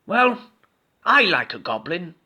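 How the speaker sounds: high, tender skeleton; kind skeleton. A narrator's reading. Skeleton reassuring Goblin, high tender. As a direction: comfort your friend as the skeleton. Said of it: "said Skeleton".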